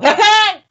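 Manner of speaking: high, loud put-on cackle; mock comedian laugh. Mocking the comedian with a loud cackle. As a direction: ham up a wacky laugh. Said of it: "and then you go".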